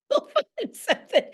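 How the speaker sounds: high, words bubbling through a laugh. Real, not acted. Teacher retelling how she reassured a student, laughing.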